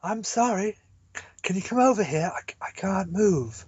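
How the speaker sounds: weak, breathless woman pleading; lightly voiced dying woman. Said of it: "you sound like a dying woman".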